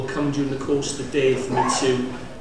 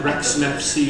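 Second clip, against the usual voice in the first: normal talking voice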